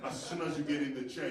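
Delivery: ordinary talk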